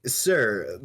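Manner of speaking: ordinary talk, nothing marked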